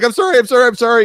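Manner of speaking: high, loud flustered sorries; high squeaky mock panic; panicked apology. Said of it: "And I'm like".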